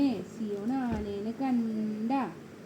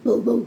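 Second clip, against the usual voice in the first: short imitated doggy bow-wow; dog bark; 2 semitones lower, 9 dB louder than usual. Shown as a demonstration. Parent showing toddler how the dog cries, imitated bark. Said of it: "ബൂബോ എങ്ങനെ കരയണേ".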